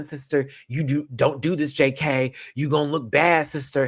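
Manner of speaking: normal talking voice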